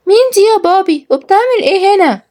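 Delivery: put-on high little girl's voice, curious; child voice. Kids asking their father about the strange woman, childlike. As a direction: speak up as a small puzzled child.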